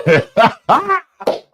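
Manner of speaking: high hearty laugh; delighted burst of laughter; gleeful laugh. Real, not acted. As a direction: crack up with glee.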